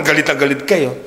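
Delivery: ordinary talk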